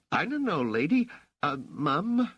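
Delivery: put-on high-pitched, unsure kid voice; a boy correcting himself, hesitant; awkward street boy. A narrator's reading. Raich fumbles answering Rochelle, awkward high boyish voice. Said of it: "Raich said".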